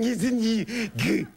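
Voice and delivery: high, slow cooing; baby talk. Retold or imitated. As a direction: croon it as a colleague walks in. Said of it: "and I'm going".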